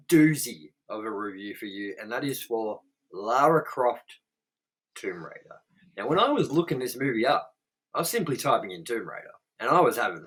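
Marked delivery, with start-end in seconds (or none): 5.0-5.6 dramatic hushed whisper of the title. Acted. Host whispering the title for mock drama. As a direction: breathe the title like a secret.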